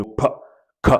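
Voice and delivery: P and K as short, isolated English consonant sounds; démonstration phonétique. Shown as a demonstration. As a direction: pop out P, then K, separately. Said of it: "apprenez comment on prononce". (P et K prononcés isolément, comme sons anglais)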